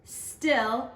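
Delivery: gentle storybook read-aloud; teacher reading a picture book to students; reading to children. Acted.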